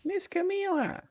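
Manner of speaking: a squeaky desperate call; high call. Acted. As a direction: cry out her name up high. Calling out to trapped girl.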